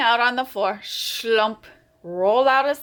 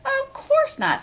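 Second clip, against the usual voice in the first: squeaky denial. Acted. Froggy denies being nervous.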